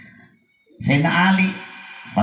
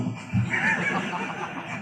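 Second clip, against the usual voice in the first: high airy laugh; 7 dB quieter than usual. Real, not acted. Joking about smoking.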